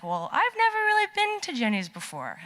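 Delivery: high-pitched, hesitant confession; sheepish admission. Retold or imitated. Student owning up to the ice cream fans, sheepish. Admitting it to the convinced group.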